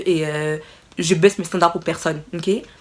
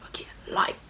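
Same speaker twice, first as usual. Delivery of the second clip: breathy conspiratorial whisper; 12 dB quieter than usual. Acted. Nudging viewers to press like, whispered. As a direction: lean in and whisper it like a secret.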